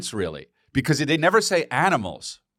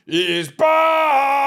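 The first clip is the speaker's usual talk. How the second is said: high, drawn-out shouted He's back; meme announcer shout; 7 dB louder than usual. Retold or imitated. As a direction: belt it like a hype meme announcer.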